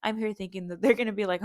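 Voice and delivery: ordinary talk, nothing marked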